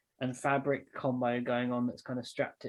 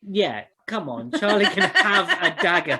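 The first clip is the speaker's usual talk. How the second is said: loud, higher-pitched mock outrage; exasperated urging; 6 semitones higher, 9 dB louder than usual. Real, not acted. Game master scolds player dithering over three daggers, exasperated. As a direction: chide them at full volume.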